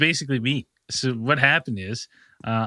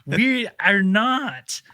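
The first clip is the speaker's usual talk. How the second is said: high, slow, nervous protest; flustered denial; 9 semitones higher, much slower than usual. Retold or imitated. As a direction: plead innocence in a high, drawn-out voice. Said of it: "We're like".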